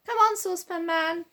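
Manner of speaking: put-on a boy urging his friend to come along, calling out. A narrator's reading. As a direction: hail him from a distance. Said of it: "called Joe".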